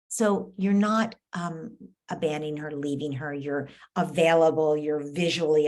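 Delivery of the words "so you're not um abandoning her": ordinary delivery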